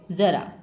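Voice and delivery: ज़रा said with a clear z sound, not j; zara with nukta. Shown as a demonstration. Teacher showing students the z sound in ज़रा. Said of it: "नुक्ता लग गया एक छोटा सा".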